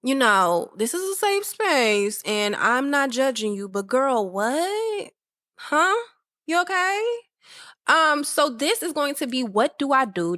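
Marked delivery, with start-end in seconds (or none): 0.8-7.0 concerned homegirl. Retold or imitated. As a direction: play the friend who can't believe it. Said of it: "and it's just like".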